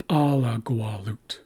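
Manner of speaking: Alagualut slowly as PA-la-gua-lut; first-syllable stress. Shown as a demonstration. Teacher shows accent shifting back, stress on first syllable. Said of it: "it shifts back to the first syllable".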